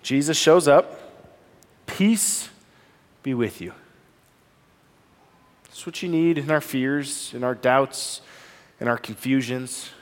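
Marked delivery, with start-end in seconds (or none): 1.9-3.7 soft, gentle peace, much quieter; quiet tender comfort; tender blessing. Acted. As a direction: breathe it like a blessing. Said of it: "the tenderness in Jesus".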